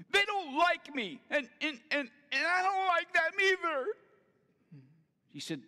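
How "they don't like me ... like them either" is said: put-on high, loud whining boy; whiny child. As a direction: complain like a sulky kid. Son whining about congregation.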